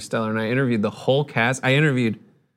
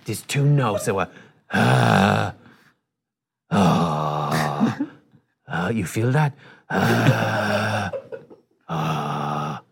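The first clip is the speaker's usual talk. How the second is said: [name]; 4 semitones lower, much slower than usual. Retold or imitated. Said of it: "if he had an accent, but he's like".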